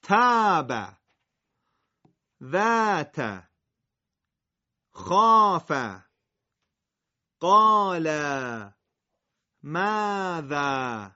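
تاب ذات خاف قال ماذا with the long vowel recited Arabic-style, loud; Arabic recitation. Shown as a demonstration. Teacher reciting Arabic words with long vowels. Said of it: "در عربی صدا ا".

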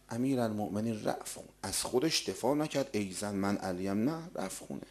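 soft, low storytelling voice; hushed story narration. A narrator's reading. Preacher recounting Ali and the orphans, hushed. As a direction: draw listeners quietly into the tale.

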